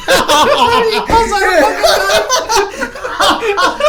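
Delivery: high, loud, helpless laughter through the words; cracking up, squealing and stretched out; laughing hard. Real, not acted. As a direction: barely get it out for laughing. Cracking up at own story.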